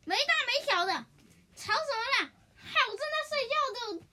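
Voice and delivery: put-on annoyed old sleeper scolding; grumpy scolding. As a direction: tell off the noisy youngster. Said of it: "奈龙就生气了".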